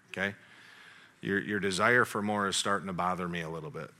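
low, soft, serious dad voice; sit the boy down and level with him; a father quietly lecturing his greedy son; serious fatherly talk. Retold or imitated.

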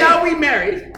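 high, loud proclamation; mock triumphant shout. Prankster announcing marriage after high five, mock triumphant shout. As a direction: declare it loudly, as a gag. Retold boast to stranger at casino.

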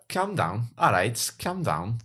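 high-pitched Scouse impression; Scouse accent. Shown as a demonstration. Asked by co-host to do a Scouse accent, high Scouse impression. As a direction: put on a squeaky Scouse accent. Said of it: "Can you do a Scouse accent".

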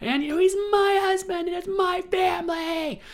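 high, loud hammed-up screech; screaming wife. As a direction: shriek it like a melodrama villain. Wife screaming during fight.

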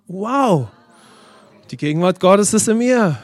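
awed exclamation. Shown as a demonstration. Preacher leading congregation to say it together, awed exclamation. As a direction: proclaim it in open wonder.

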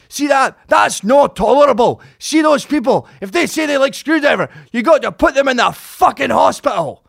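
loud, high-pitched Scottish shout; Scottish punk singer. Retold or imitated. Singer dedicating a song from the stage, shouted Scottish accent. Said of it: "'cause of his accent".

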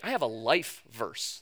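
lightly mocked churchgoer, low and soft, slow; mocking Christians. Preacher teasing Christians about life verses, softly mocking. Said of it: "Christians have this weird thing".

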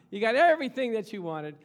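loud laughing proclamation; loud, laughing sermon cry; laughing exclamation. Real, not acted. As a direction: burst out with joy through a laugh.

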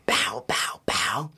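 hushed, spaced-out punching noises; imitated punch sounds. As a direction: mime blows with soft vocal effects. Imitates punches to own face.

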